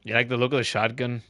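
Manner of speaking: light put-on Australian accent, mate on every line; playful mock Aussie streamer voice. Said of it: "I'm stuck with an Australian accent".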